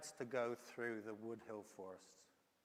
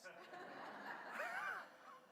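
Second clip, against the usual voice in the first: a high squeal of a laugh; 6 dB quieter than usual. Real, not acted. Preacher laughing at his own punchline, high. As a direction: let a high giggle break out.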